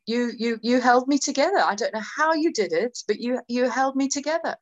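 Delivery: normal talking voice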